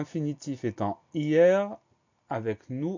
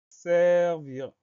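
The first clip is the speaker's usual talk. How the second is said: "servir" drawn out and higher; dictation style; 6 semitones higher, 4 dB louder than usual. Shown as a demonstration. Teacher announcing the next spelling word, "servir" drawn out. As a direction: announce "servir" with long, careful syllables.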